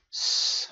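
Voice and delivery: a long voiceless s, slowly, no vowel; hissed s sound. Shown as a demonstration. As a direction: hiss a slow s alone. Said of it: "اس نخونید". (صدای س کشیده)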